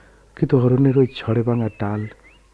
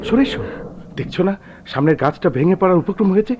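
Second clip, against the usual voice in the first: much higher and louder, urgent yelling; frantic shout; 9 semitones higher, 8 dB louder than usual. Acted. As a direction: scream at her to get away. Husband yells warning in storm. (ঝড়ে স্ত্রীকে সরে আসতে চিৎকার)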